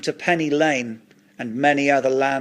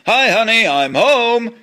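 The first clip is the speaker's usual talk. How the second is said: higher, louder sing-out of a greeting; homecoming call; 6 semitones higher, 6 dB louder than usual. Acted. Husband calls home to his honey, singing out a greeting. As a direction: call it out brightly to the house.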